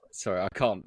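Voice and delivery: normal talking voice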